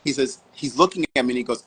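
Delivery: ordinary talk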